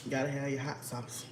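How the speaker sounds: ordinary talk, nothing marked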